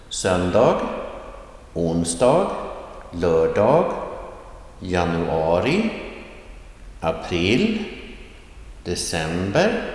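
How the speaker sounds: "Söndag, onsdag, lördag, januari, april, december", each starting low and sweeping up at the end; rising tone. Shown as a demonstration. Teacher models weekdays and months, rising tone on "Söndag, onsdag, lördag, januari, april, december". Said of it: "pronounce the accent one with a rising tone".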